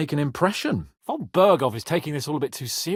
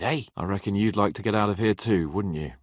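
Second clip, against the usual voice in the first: ordinary talk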